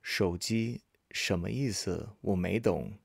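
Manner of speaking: slow, spaced-out model phrase; measured teaching pace with small gaps; slow repeat. Shown as a demonstration. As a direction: say it slowly for learners. Model phrase repeated slowly for learners.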